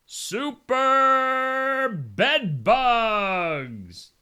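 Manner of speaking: high, very slow "Super bed bugs" drawn out like a trailer; dramatic movie-trailer voice. Pretend. Host hyping bugs in a drawn-out movie-trailer voice. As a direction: announce the monster bugs like a film title.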